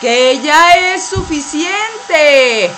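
frustrated call over the blower. Acted. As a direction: push it out over the roar. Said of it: "pero no me grites".

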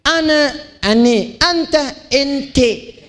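ane, ente with E instead of A; Arabic vowels shifted from A to E; imalah E sounds. Shown as a demonstration. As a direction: say ana then ane, anta then ente. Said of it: "dia bilang E".

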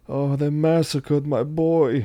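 slow, a touch of grieving mobster; lightly voiced Brando, fighting back a tear; Godfather quote. Quoting the Godfather at the funeral home, slow and grieving. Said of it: "And he goes".

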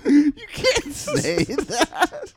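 high, drawn-out words through laughter; helpless laughter. Real, not acted. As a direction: protest slowly while cracking up. Cracking up at shocking joke.

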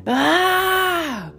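high, drawn-out yell; sustained "aaah" scream; loud yell. A narrator's reading. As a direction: let out a long, high battle scream. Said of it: "urlò ancora più forte".

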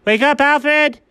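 high, urgent cry; urgent wake-up call. Acted. Character waking Alfred from a dream, urgent. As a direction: call out high to rouse a sleeper.

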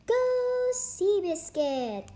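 high, slow cheering shout. A narrator's reading. Sierra cheering on Seabiscuit in the library, shouting. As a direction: yell for your horse to win. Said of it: "shouted Sierra".

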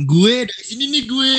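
high, hammed-up Jaksel kid voice; Jakarta slang. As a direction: show off the Jakarta gue. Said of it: "kalau denger sama temen sendiri".

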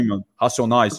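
low São Paulo street-slang voice; São Paulo accent. Acted. Guest imitating how São Paulo talks, low paulistano accent. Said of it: "aqui fala tudo assim".

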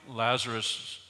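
ordinary delivery

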